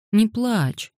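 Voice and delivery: alarmed little soothing interjection; quick reaction. A narrator's reading. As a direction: jump in quickly to head off tears. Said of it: "быстро отреагировал Маркус".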